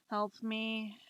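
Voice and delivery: ordinary talk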